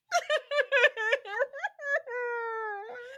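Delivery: helpless high-pitched cackle; high shrieking laugh. Real, not acted. Laughing hard at a friend's Dracula joke, high shrieking laugh. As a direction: break into a squealing laugh.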